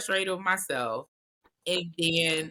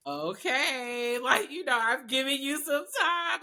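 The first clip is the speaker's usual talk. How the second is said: light, higher mock husband voice; a gentle, hopeful check-in, voiced higher; imitating husband; 7 semitones higher than usual. Husband checking in after a few hours, mild mock voice. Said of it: "he'll come and be like".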